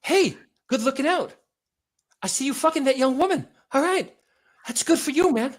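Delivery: high, out-of-breath chummy greeting; Mark Wahlberg. Acted. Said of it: "Always sounds like he's out of breath".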